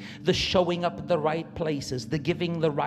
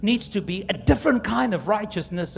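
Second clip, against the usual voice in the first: full-force preaching; 6 semitones higher, 6 dB louder than usual. Acted. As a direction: drive it home from the pulpit.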